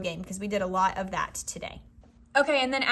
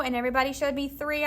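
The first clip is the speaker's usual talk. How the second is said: usual speaking voice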